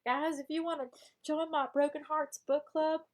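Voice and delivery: hammed-up Southern book club leader; improvised Southern character. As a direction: play a chatty Southern breakup-advice character. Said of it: "I'm just improving now for this character I created".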